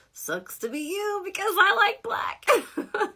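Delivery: high, drawn-out mock retort; sassy comeback. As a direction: toss it back sing-song and slow. Acted comeback to friend's remark.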